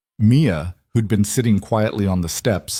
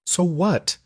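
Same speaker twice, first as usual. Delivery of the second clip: higher, breaking with distress; voice cracking; 9 semitones higher than usual. Acted. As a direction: voice cracks on the words. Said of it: "Lena's voice cracked".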